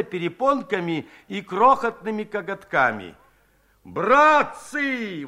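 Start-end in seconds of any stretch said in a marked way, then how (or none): 3.9-5.2 higher, a bit louder startled call; shaken exclamation. Acted. Stunned Akim calls to the men, a shaken exclamation. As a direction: call out in shock. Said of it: "воскликнул потрясённый Аким".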